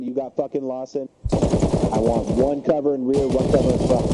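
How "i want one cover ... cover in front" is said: loud, slow, deliberate command; shouting orders over battle. Real, not acted. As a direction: spell out each order at full volume. Leader organising cover after hit.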